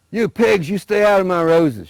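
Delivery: shrieking high and loud at intruders; angry yell. Acted. Old woman yelling at pigs.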